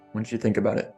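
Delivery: ordinary talk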